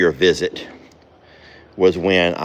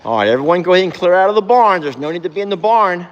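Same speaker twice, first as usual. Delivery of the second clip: high, loud call to the animals; 8 semitones higher, 3 dB louder than usual. Real, not acted. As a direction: raise the voice and shoo them out. Calling horses out of barn.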